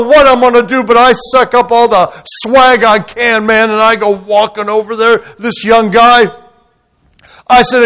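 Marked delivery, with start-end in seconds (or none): none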